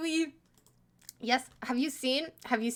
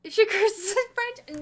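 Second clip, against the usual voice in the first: laughing; 8 semitones higher, 3 dB louder, slower than usual. Real, not acted. Laughs while reading chat comment.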